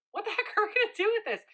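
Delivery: high, fast, giggly panic; giddy disbelief. Retold or imitated. Couple laughing in the truck about seven kids, high and fast. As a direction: toss it off laughing at the absurdity.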